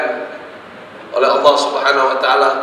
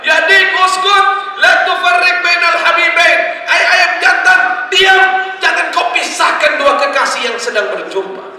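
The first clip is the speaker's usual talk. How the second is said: scolding cry to rooster; 13 semitones higher than usual. Acted. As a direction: shout at the rooster to hush.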